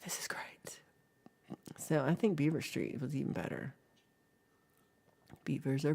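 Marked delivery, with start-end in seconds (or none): 0.0-0.8 whispered pretend aside; hushed delight. Streamer privately savoring the imagined absurd address, hushed mock delight. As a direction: drop to a tiny whisper after the shock.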